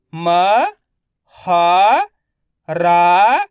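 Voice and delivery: म, ह, रा each held alone; letter-by-letter sounding. Shown as a demonstration. Teacher for pupils to repeat, म, ह, रा sounded separately. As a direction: stretch म, ह and रा with pauses between. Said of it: "आपको मेरे साथ साथ रिपीट करना है".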